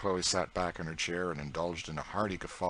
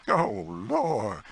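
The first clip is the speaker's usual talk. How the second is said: higher, louder delighted cry; delighted exclamation; 11 semitones higher, 7 dB louder than usual. A narrator's reading. Aunt Chloe crowing with delight at George's joke, loud glad cry. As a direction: whoop it out in glee.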